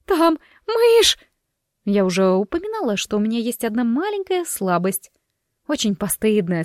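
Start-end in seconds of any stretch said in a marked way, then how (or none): 0.1-1.2 high, loud shriek of pure panic; terrified shriek. Acted. Miranda shrieks at her husband about the mouse. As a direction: scream it while leaping away.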